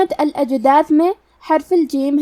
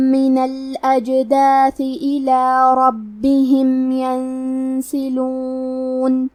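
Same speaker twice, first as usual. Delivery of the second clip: slow, drawn-out tajweed recitation; Quran recitation; much slower than usual. Shown as a demonstration. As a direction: chant each word slowly and melodically. Teacher recites Quran verses. (آہستہ، ترتیل کے ساتھ تلاوت)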